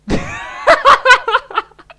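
shrieking burst of laughter; high squealing laugh; loud high laugh. Real, not acted.

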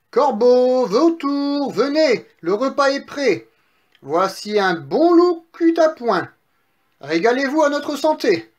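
high, loud, festive summons; calling out. Acted. Pigs summon crow and vulture to the meal, jubilant calling. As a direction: call far and wide, stretching the words. Pigs call birds to feast.

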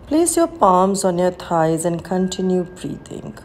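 normal talking voice

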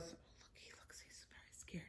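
playful frightened whisper; hushed pretend fear for children; mock scared whisper. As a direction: whisper as if the dinosaurs might hear. Scared dinosaurs page.

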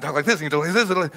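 rapid-fire gabble; sped-up talking. Shown as a demonstration. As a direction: imitate audio played at double speed. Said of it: "the people talk real fast".

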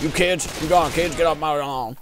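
a hint of a grumpy old man yelling at kids on his roof. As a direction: shout like a cranky neighbor chasing kids off. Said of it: "I'm old man".

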